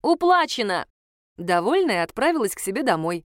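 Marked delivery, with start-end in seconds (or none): 0.0-0.9 high, moderately raised cry; a contained storyteller's shout; calling out. A narrator's reading. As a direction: call it out high and decisive. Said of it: "и крикнула".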